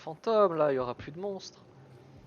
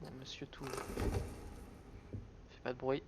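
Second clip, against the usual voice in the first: hushed, slow whisper in hiding; whispering while hiding; 9 dB quieter, much slower than usual. Real, not acted. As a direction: breathe it out so nothing hears. Hiding from a monster.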